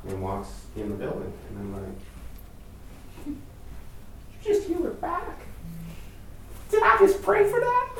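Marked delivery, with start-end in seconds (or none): 3.3-7.9 much higher, incredulous squeal; stunned disbelief. Retold or imitated. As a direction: sound floored that it worked. Stunned after prayer works.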